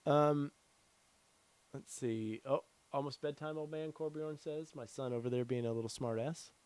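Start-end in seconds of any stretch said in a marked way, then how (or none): none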